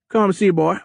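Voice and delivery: high, loud Cajun captain; big Cajun voice, pitched high; thick Cajun accent. A narrator's reading. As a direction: summon him loudly in Cajun. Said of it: "in a thick Cajun accent".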